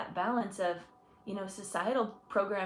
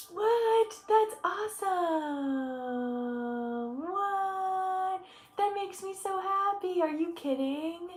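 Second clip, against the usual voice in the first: gasp, then a much higher, delighted what; thrilled disbelief, pitched way up; overjoyed surprise; 9 semitones higher, slower than usual. Real, not acted. Reacting to a viewer comment.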